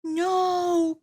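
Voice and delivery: high alarmed cry; cried out. A narrator's reading. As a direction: panic at the flutter. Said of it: "cried Fred".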